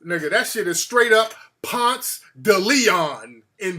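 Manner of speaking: "Ponce de Leon" slow as "Pahnts tuh Lee-ahn"; slow local "Ponce de Leon"; Atlanta pronunciation. Shown as a demonstration. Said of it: "stop pronouncing the shit correctly".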